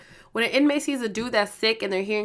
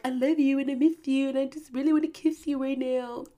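imitated lovey pleading, pitched higher; boyfriend's troubled tone; 4 semitones higher than usual. Girlfriend mimicking how he sounds when troubled, higher lovey voice. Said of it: "you sound like that".

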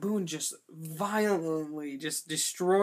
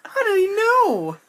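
much higher, louder, slow disbelief; incredulous; 12 semitones higher, 7 dB louder than usual. Real, not acted. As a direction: ask it in amazed disbelief. Incredulous about the movie.

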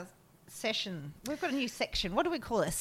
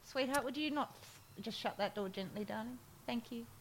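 much softer, sweetly polite request; soft polite aside; 16 dB quieter than usual. Real, not acted. Host quietly asking her partner off-mic to close the door. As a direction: hush it to someone off-mic.

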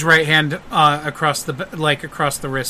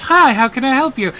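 high, loud bubbly helper; bubbly lady; 10 semitones higher, 6 dB louder than usual. Acted. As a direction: chirp it out brightly to a customer. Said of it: "she says".

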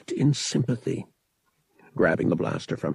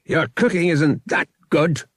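louder, higher mocking jab; sneering taunt; 8 semitones higher, 5 dB louder than usual. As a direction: sneer at the Wookiee. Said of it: "he snapped in a voice nearly as harsh".

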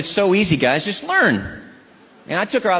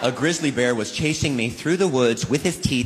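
usual speaking voice

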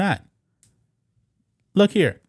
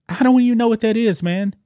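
incredulous; 8 semitones higher, 3 dB louder than usual. Real, not acted. Baffled by the neopronouns ze and em.

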